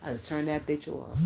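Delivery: usual speaking voice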